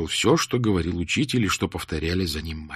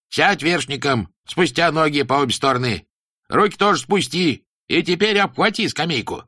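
stern teacher voice; 7 semitones higher, 4 dB louder than usual. Acted. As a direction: preach it like a strict old teacher. Teacher orders boy.

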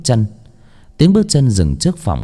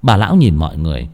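ordinary delivery

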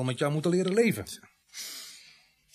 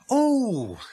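surprised; 12 semitones higher, 7 dB louder than usual. Acted. Surprised by the vicar on phone.

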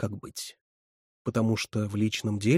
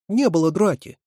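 high, louder tearful denial; sobbing protest; 8 semitones higher, 7 dB louder than usual. A narrator's reading. Катерина denies there was a fight, sobbing. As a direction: protest as if near tears. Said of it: "всхлипнула Катерина".

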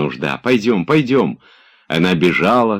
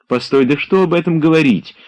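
much higher, louder playful brush-off; playful dismissal; 12 semitones higher, 5 dB louder than usual. A narrator's reading. Olga brushing off Oblomov's question, playful. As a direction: laugh the question away.

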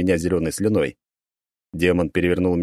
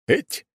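sharp high grunt of effort; 12 semitones higher than usual. Acted. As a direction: grunt as you pull up. Effort climbing.

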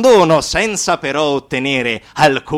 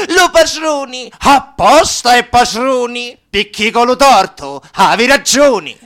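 accusa concitata, acuta e forte; 9 semitones higher, 6 dB louder than usual. Acted. Voce dialettale che accusa.

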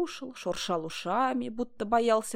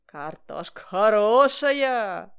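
slow, loud teasing call; shouted sly pitch; 7 dB louder, much slower than usual. A narrator's reading. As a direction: tempt him loudly and slyly. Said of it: "лукаво кричал".

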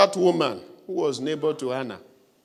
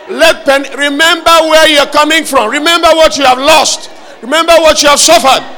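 rising, fervent preacher's cry building louder and higher; preaching at full force; 7 semitones higher, 12 dB louder than usual. Acted. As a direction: whip up the whole congregation, growing to a roar. Preacher drives congregation to pray.

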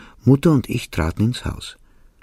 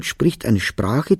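usual speaking voice

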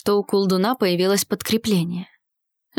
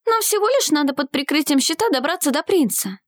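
put-on higher-pitched girl's character voice; urgent young heroine; 7 semitones higher than usual. Girl urging ally toward the prince, high insistent voice. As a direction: press the plan on him, pitched up.

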